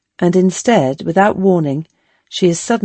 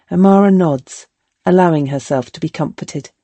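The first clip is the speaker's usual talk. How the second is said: ordinary delivery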